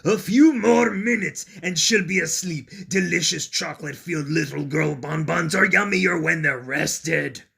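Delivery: loud, booming gloating monster; big gloating dinosaur voice. A narrator's reading. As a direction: gloat over the sleeping prey like a hungry dinosaur. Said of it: "a dinosaur gloating".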